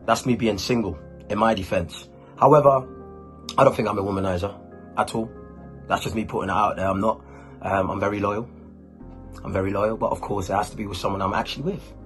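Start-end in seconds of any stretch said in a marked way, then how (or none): none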